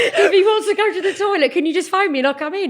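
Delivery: high and breaking with laughter; laughing. Real, not acted. Mum laughing as she retells the school phone call. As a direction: laugh through the whole request.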